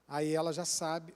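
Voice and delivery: ordinary delivery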